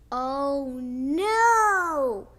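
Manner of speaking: loud dismayed "oh no"; alarmed gasp. A narrator's reading. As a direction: cry out in shock at the fall. Reacting to Pops' fall.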